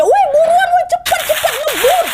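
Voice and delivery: very high-pitched urgent yell; panicked shout. Acted. Irma urging friends to flee, panicked high shout. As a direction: yell at them to speed off, voice shooting up. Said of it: "langsung dia teriak".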